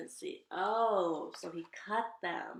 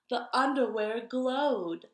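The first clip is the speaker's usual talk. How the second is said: plain; 3 semitones higher, 7 dB louder, slower than usual. A narrator's reading. Spooky storytelling moment.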